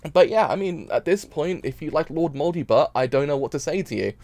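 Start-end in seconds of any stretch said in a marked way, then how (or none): none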